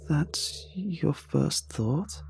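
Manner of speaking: very slow, soft, high voice; incredulous question. A narrator's reading. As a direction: draw it out softly in disbelief. Anne doubts narrator's guess.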